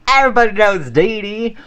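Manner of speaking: high, loud mock proclamation; Dee Dee. Recalling the lady's catchphrase from the story, mock proclamation. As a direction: quote the caricatured lady with relish. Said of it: "we think".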